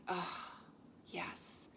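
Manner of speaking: breathy, whispered yes of relief; relieved sigh. Retold or imitated. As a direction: exhale it like a weight lifting. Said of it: "took the biggest sigh of relief and was like".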